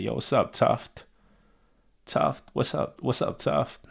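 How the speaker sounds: imitating a deadpan serious greeting; mad serious. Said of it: "he comes in mad serious".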